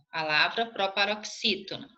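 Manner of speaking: ordinary delivery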